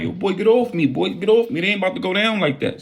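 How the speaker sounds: high, fast panicked pushback; high alarmed protest. Retold or imitated. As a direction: squeal get off me. Struggling, panicked.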